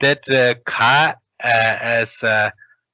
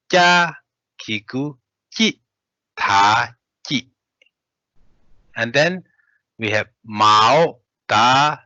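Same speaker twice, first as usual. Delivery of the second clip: teacher drilling the spelling. Shown as a demonstration. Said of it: "let's read spell out".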